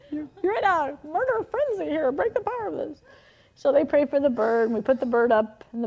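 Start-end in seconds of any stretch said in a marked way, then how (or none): 0.1-3.0 high-pitched, overwrought rebuke; mock frantic prayer. Aunt praying over the naughty children, mock frantic. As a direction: pray it in comic hysteria.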